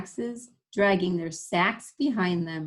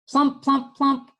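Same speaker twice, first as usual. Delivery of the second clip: loud, high thumping pillow sounds; loud plump sounds; 6 semitones higher, 7 dB louder, slower than usual. Acted. Reader voicing a wild pillow fight, loud and high. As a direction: punch each plump out.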